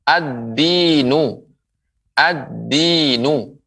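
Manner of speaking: "Ad-dinu" very slowly with doubled d; slow model of "Ad-dinu", no l sound; lam merged into dal. Shown as a demonstration. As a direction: stretch "Ad-dinu", holding the d and long i. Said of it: "huruf dal".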